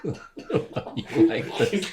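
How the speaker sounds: breathless giggly question; laughing. Real, not acted. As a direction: ask it through laughter. Laughing at friends' casting jokes.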